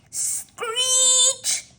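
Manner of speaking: high cat screech. Acted. Kitty screeching as everyone flees, high.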